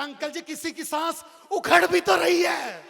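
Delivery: loud, high and slow comeback; slow, loud, high shout; shouted retort. Acted. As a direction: yell the punchline at him. Boy shouts comeback to uncle.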